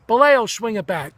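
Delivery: calling out. Retold or imitated. Manager calling a player up.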